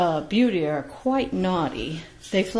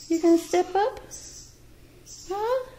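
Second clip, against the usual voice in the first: higher coaxing question to a bird; high pet talk; 12 semitones higher than usual. Real, not acted. Owner asking Beauty to step up, high pet voice.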